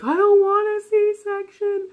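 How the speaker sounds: very high-pitched, drawn-out wail; mock bawling. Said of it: "just start bawling".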